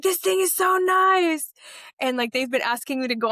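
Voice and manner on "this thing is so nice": much higher, louder shout with a laugh, slow; imitating the niece screaming with joy; excited shriek. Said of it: "And she's like".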